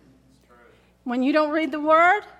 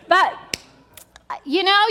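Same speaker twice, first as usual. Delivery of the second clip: ordinary talk, nothing marked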